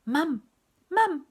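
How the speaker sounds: put-on high, loud baby cry; loud, high toddler blurts; baby's first word. A narrator's reading. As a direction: pipe it up like a delighted baby. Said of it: "she said".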